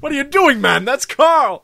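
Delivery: high, loud mock outrage; high outraged yelp. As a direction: yelp it in shocked disbelief. Acted outraged victim.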